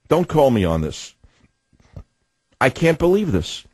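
normal talking voice

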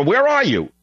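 high-pitched mild mock yell; retold call, pitched well up; mock scream. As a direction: imitate someone yelling, without much volume. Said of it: "They're screaming for".

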